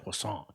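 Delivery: "croissant" said the French way, as "kwah-sahn". Shown as a demonstration. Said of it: "it's pronounced".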